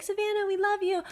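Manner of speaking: high singsong mimicry of gushing friends. Woman mimicking her ex's friends on a call, gushing. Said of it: "all your friends would be like".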